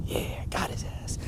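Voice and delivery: whispered, slow and gloating; smug whisper. Retold or imitated. Baby pleased he got out, whispered and gloating. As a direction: whisper it like a baby who just won. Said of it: "I see this face I make".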